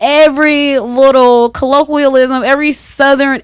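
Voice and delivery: loud, high Southern flourish; Southern colloquial. Retold or imitated. Woman to friends, owning her Southern accent loudly. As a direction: lean into the Southern talk, proudly.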